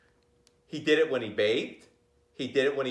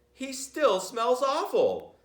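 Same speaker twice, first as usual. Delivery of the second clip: mother's complaint; 11 semitones higher, slower than usual. A narrator's reading.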